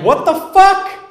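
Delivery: high, loud shocked yelp; startled yell. Retold or imitated. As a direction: yelp it out as the phone hits you. Phone hits him in head.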